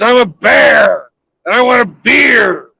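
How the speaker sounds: high, loud mock bear demand; hammed-up bear yelling for a beer; shouting bear. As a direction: bellow it like a cranky bear. Bear demands beer third time.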